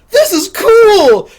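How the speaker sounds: high, loud, slow delight; gleeful. Retold or imitated. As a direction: savour it like a happy brawler. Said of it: "I was like".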